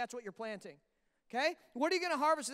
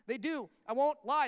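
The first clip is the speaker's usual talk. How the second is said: normal talking voice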